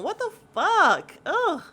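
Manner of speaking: high, loud, drawn-out mock outrage; slow, incredulous outburst; exasperated disbelief. Exasperated retort to Jacqueline.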